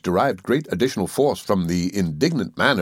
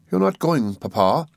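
anxious; 6 semitones higher than usual. A narrator's reading. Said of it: "said Agnes anxiously".

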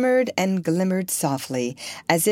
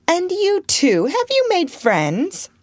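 sudden, high-pitched query; spring the question, pitched high; abrupt question; 12 semitones higher, 3 dB louder, slower than usual. A narrator's reading. Lady turning suddenly to aunt and guest, abrupt question. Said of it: "asked abruptly".

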